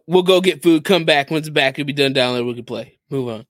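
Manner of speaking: usual speaking voice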